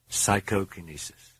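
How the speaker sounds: ordinary delivery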